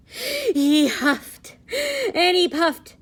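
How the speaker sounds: loud, high dramatic huffing; huffing and puffing. A narrator's reading. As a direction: blow it out big like the wolf. Wolf huffing in story.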